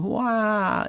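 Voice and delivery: short hammed-up wail; a quick high "Wah!" like Lucy; mock wail. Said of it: "Lucy Ricardo wailing".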